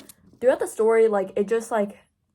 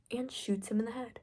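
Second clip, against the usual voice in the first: breathless hushed whisper; 2 semitones higher, 10 dB quieter than usual. Real, not acted. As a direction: drop to a whisper for the shock. Dramatic climax of recap.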